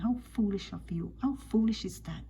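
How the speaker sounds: ordinary talk, nothing marked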